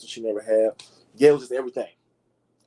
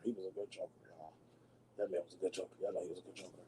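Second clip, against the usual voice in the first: hushed reverence; 4 semitones lower, 18 dB quieter, faster than usual. Real, not acted. Reverent about Heath Ledger.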